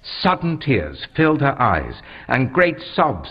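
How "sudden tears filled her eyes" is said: plain reading voice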